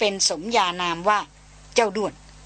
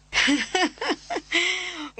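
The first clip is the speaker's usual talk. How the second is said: hearty laugh; 9 semitones higher than usual. A narrator's reading. Chayan laughs at Darin.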